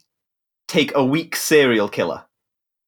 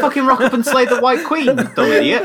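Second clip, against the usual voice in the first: exasperated; 10 semitones higher, 3 dB louder than usual. Real, not acted. Heated joking argument.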